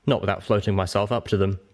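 ordinary delivery

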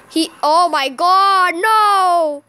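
loud, high, drawn-out cries of no; anguished wail. Real, not acted. Player watching the game slip away, anguished wail. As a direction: wail in helpless dismay. Wailing as rocket hits.

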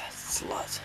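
sighed out, voiceless and very soft; whispered insult. Real, not acted. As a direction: whisper the insult under your breath. Muttered insult at game character.

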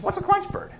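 puzzled wife. Acted.

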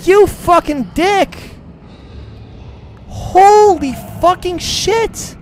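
drawn-out, very high, very loud screams; terrified screaming. Real, not acted. Gamer screaming in terror at a jump scare. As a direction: scream in real fright, stretching each word.

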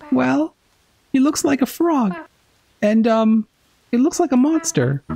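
put-on a shy child tugging at a grown-up; polite little kid. The child politely gets the drunk knight's attention, in a high shy voice.

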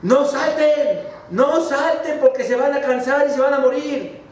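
high shouted warning; frantic relatives yelling down the well; frogs shouting. Acted. Relatives shouting down into the well, high frantic yell. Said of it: "gritándoles".

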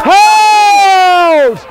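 long high shouted hold; long shouted call. Real, not acted. Referee calls hold in play.

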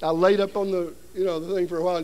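normal talking voice

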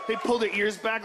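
ordinary delivery

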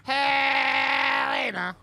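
long drawn-out loud call. Real, not acted. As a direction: call her name across the map. Calling out to game partner.

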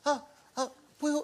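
high, halting imitation of little kids; adorable children. Kids begging director to free their dad, childlike. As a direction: plead like a small child for a favour. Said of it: "his kids sent the most adorable video".

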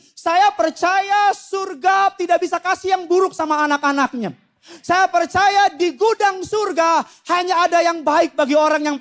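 berkhotbah tegas, lebih tinggi dan lantang; penegasan ulang. Real, not acted. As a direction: tekankan "Saya percaya" dengan suara naik, pelan dan mantap. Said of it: "saya ulang sekali lagi". (menegaskan keyakinan dengan lantang)

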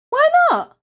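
very high, loud whine of protest; put-out child's cry; protesting question. Acted. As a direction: demand a reason in a squeaky burst. Boy protests refused swap.

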